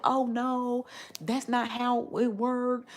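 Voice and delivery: mocking the critics. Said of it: "But people's like".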